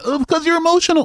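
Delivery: very high, loud mocking squeak; mocking whine. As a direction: squeal it to ridicule him. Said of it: "but he's like".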